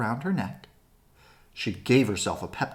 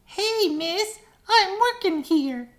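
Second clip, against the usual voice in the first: much higher, louder little bug voice; tiny shouting voice; 6 dB louder, slower than usual. Acted. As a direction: shout it like a tiny busy critter. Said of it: "a tiny voice shouted".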